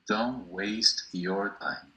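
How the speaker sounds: Don't waste your time spoken very slowly, word by word; slow model phrase. Shown as a demonstration. Teacher slowing down Don't waste your time for students.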